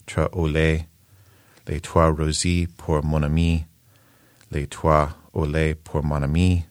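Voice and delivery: poem read in American-accented French; measured French verse with a non-native accent; broken French recitation. Shown as a demonstration. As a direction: recite slowly in halting amateur French, phrase by phrase. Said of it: "in badly broken French first".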